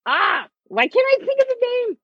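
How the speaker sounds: high whiny complaint; frustrated whine. Real, not acted. Trying to recall a character's name, high frustrated whine. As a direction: grasp at a name you can't recall.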